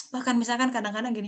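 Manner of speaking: usual speaking voice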